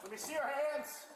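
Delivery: loud, firm police command; commanding shout. Real, not acted. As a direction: bark it at the suspect. Deputy confronting a suspect.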